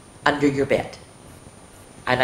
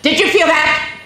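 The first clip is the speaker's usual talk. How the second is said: much higher startled question; 12 semitones higher, 6 dB louder than usual. Acted. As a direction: jolt of alarm. Sudden fright in story.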